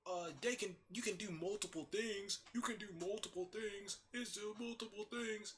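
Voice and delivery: high, whiny-repetitive mimicry of an objector; mock objector, pitched well up; mocking an idiot. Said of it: "for any idiot out there who's like".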